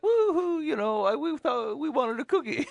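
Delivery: hammed-up whiny kid crying over a cookie; imitating crying kid. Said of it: "one of them will cry".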